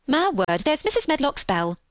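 a touch of hurried alarm; startled maid. A narrator's reading. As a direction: exclaim as a maid suddenly summoned. Narrator voices Martha.

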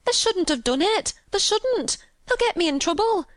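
high, fretful wailing; tearful panic, pitched high throughout; half crying. A narrator's reading. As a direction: lament it in a high Yorkshire maid voice. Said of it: "half crying".

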